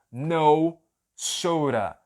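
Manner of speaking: "no soda" slow, the O gliding to U, "nou sora"; slow model. Shown as a demonstration. Teacher drilling "no soda" slowly for learners. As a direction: stretch "no soda" with "no" ending in U. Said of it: "E a pronúncia".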